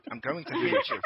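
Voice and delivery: very high, loud mock English threat; horrible English accent. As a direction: squeal it in a bad English accent. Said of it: "but with a horrible accent".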